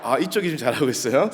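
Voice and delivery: normal talking voice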